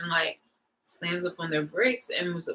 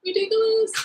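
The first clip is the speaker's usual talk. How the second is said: exasperated. Retold or imitated. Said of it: "so I'm just like".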